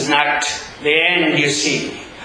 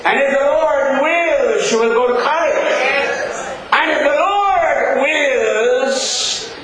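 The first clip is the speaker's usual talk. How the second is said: building sermon refrain; 12 semitones higher than usual. Acted. Preacher building the refrain about his granddaughter, rising to a shout. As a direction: climb with every if the Lord wills.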